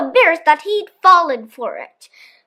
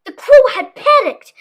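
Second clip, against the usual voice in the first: high and loud, a sudden fright; alarmed narration; 8 semitones higher, 4 dB louder than usual. A narrator's reading. Narrator voicing the crow's panic, high and alarmed. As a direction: tell the panic with a jolt.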